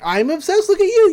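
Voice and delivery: gushing over a brand new crush; gushing excitement. Retold or imitated. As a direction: squeal it like a smitten girl. Mabel gushing over puppet.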